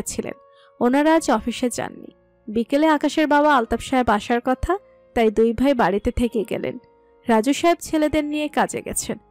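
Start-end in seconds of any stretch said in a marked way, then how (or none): none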